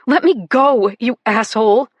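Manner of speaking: yelling to be released; furious, loud protest; angry shout. Acted. Struggling against her captor.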